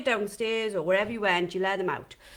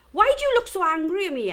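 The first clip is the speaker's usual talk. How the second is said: whiny, hammed-up child, much higher and louder; dramatic kid; 9 semitones higher, 6 dB louder, faster than usual. Son making a big drama at his mum in the supermarket, higher and louder. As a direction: make a big drama of it like a hurt little boy. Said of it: "he would make a big drama".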